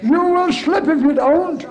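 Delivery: preacher's urgent repeat; emphatic warning. Acted. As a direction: drive the warning home hard.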